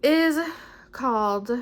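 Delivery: a little breathless, words pushed out; out of breath. Real, not acted. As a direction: speak short of breath. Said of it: "see, I'm out of breath".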